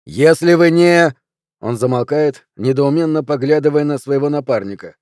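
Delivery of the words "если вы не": loud, high, slow attempt; faltering recital. A narrator's reading. Policeman stuck mid-warning, loud and drawn out. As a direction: stretch it out, then break off.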